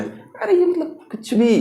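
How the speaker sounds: high, soft laughing protest; amused, incredulous and high-pitched; laughing disbelief. Acted. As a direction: squeak it out quietly through a laugh. Mocks the odd silent S spelling.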